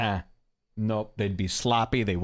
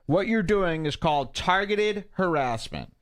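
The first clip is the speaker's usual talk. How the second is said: mock raised voice for the scold; reading a quote; 8 semitones higher than usual. Man reading a stranger's scolding tweet aloud, mock raised. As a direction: read it like a prissy scold. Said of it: "He says".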